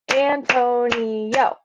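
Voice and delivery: Antonio split into four drawn-out beats, An-to-ni-o; syllable by syllable. Shown as a demonstration. Teacher giving a full name, Antonio syllable by syllable. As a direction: clap out Antonio, each syllable separate. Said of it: "four beats or four syllables".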